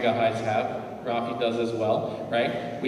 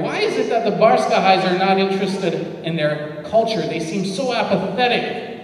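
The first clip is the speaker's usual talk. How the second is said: put-on naive teen complaint; 5 semitones higher, 5 dB louder than usual. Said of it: "I go".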